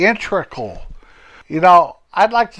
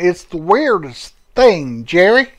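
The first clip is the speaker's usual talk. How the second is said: put-on lightly raised folksy old fellow, cheerful; chatty old Homer greeting his friend; 7 semitones higher than usual. Homer greets his old friend Jerry, lightly raised folksy voice.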